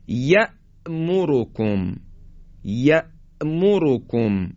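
"یأمرکم" slowly, with a sharp stop after "ya"; still hamza. Shown as a demonstration. Teacher models یأمرکم, hamza stopped sharply. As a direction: halt on the hamza in "یأمرکم", then continue. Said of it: "این طرز تلفظ همزه در حالت سکون هست".

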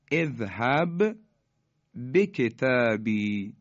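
slow, drawn-out tajweed chant; Quranic recitation. Shown as a demonstration. Teacher reciting an example verse for the class, chanted slowly. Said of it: "توجه بفرمایید".

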